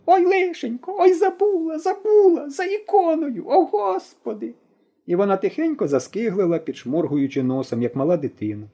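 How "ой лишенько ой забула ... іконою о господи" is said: very high, frantic granny cry; panicked old woman's wail. A narrator's reading. Granny remembers the icon and wails, very high. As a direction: wail in sudden panic over the forgotten icon. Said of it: "раптом зойкнула".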